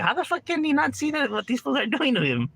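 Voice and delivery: high incredulous mock outburst; disbelief. A viewer watching a streamer get played, high disbelief. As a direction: act baffled at what he misses. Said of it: "And I'm just like".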